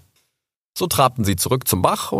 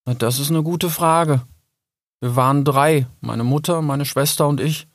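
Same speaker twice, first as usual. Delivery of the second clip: higher, lightly voiced young fox; wistful fox; 5 semitones higher than usual. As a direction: recount a lost family thoughtfully.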